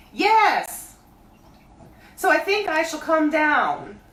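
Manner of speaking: high, loud bear calling down; Pooh voice. A narrator's reading. Pooh calling down to Christopher Robin, high bear voice. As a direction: call up from the honey tree like a bear.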